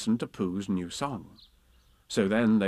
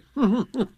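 owl laugh; 12 semitones higher, 6 dB louder than usual. Acted. Owl's flustered laugh.

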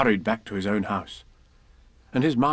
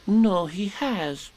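solemn Piglet; 8 semitones higher, much slower than usual. Acted. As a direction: speak gravely as a small piglet.

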